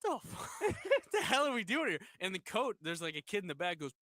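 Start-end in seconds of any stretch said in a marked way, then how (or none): 0.0-2.0 high, laughing disbelief; baffled catcher. Retold or imitated. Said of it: "starts looking around like".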